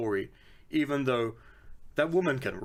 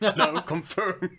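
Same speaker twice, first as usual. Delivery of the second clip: laughing; 6 semitones higher, 4 dB louder, slower than usual. Real, not acted. Laughing correction.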